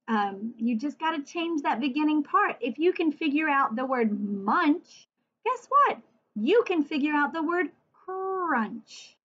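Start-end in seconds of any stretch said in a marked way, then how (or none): 5.4-6.0 high bright guess what; spring the surprise on them; a sudden lift of delight; playful surprise. Acted. Teacher building suspense.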